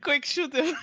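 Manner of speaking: loud urgent command; urgent shout. Acted. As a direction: bark it out in a hurry. Urgent in-character order.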